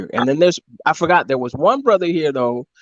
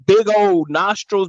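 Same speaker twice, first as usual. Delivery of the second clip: ordinary talk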